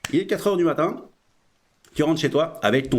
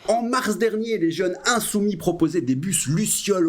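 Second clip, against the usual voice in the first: ordinary delivery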